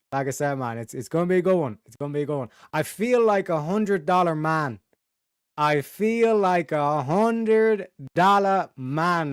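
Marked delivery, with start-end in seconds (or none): 5.6-9.3 very slow, every word stretched out; drawn-out brag. Shown as a demonstration. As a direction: savour it like a proud boast. Repeats catchphrase, second time stretched.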